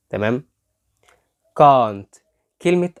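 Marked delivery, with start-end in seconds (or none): 1.6-2.2 "can't" as a loud "caahnt"; British can't. Shown as a demonstration. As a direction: model "can't" with a long back "ah". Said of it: "الصوت بتاع ال آ".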